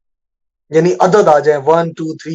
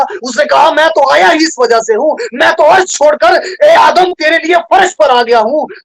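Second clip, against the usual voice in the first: full-force preaching; 9 semitones higher, 4 dB louder than usual. Acted. Preacher tells of Jesus seeing Adam's loss, full-force preaching. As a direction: proclaim it at a shout, drawing out the phrases.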